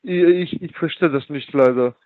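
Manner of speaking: ordinary delivery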